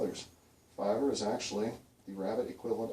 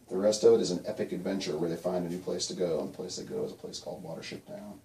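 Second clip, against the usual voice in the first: ordinary delivery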